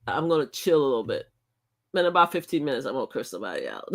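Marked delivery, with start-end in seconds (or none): 1.9-3.9 quick, softer words through a laugh; laughing threat. Real, not acted. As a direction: toss off the threat while laughing. Joking threat to curse people.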